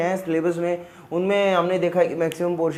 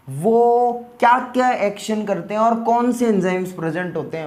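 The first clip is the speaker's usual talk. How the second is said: ordinary talk, nothing marked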